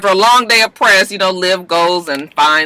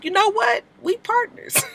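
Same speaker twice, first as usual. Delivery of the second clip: high, soft scared squeak; nervous giving in; 6 dB quieter than usual. Retold or imitated. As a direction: squeak out a quick surrender. Frightened Cyrus gives in.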